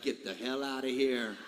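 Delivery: put-on understated Kentucky accent, low and dismissive; imitated Kentucky accent. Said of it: "that Kentucky accent".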